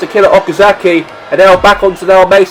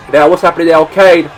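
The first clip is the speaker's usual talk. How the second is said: normal talking voice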